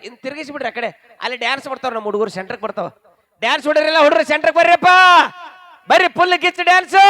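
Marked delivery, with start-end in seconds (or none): 3.4-7.0 loud, high rallying shout; real crowd-hyping yell; calling out. Real, not acted. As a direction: rouse the dancers from the stage. MC calling dancers to the centre.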